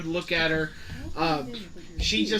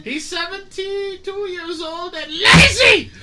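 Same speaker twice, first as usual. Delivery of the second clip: high, loud doddering granny; a cheeky old crone selling her husband; cackling old lady; 13 semitones higher, 9 dB louder than usual. Acted. GM holds old woman character.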